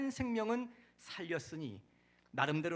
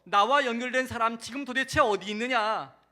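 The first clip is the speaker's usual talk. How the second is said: loud, raised-pitch searching question; demanding call; 5 semitones higher, 11 dB louder than usual. Acted. Preacher as the Lord seeking someone, loud demanding call. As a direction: call out with authority across a crowd.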